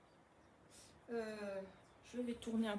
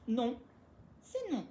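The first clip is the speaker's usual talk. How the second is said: firm no to a dog; 6 dB louder, slower than usual. Real, not acted. Forbidding dog the treat.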